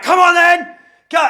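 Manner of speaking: very loud, high-pitched taunt; re-enacted screamed challenge. To the old man backing away, acted screamed challenge. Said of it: "I'm screaming".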